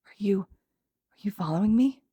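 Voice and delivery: hesitant, whispery, slow; quiet question. A narrator's reading. Izuku questioning the villain who followed him, quietly. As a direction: ask it timidly, half whispered. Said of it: "Izuku asked quietly".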